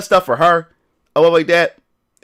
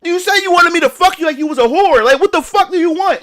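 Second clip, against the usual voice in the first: much higher, exasperated demand; frustrated; 13 semitones higher than usual. Retold or imitated. To baby mom who felt used, high frustrated outburst. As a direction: throw it back at her, fed up. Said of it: "I was getting frustrated".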